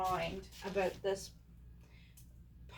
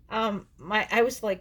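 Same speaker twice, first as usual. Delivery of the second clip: usual speaking voice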